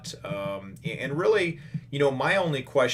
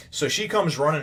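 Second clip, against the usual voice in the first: ordinary talk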